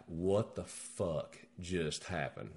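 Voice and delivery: slow, subdued disbelief; stunned, no excitement. Retold or imitated. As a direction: retell it slowly and softly, in a numb daze. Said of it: "there's no excitement".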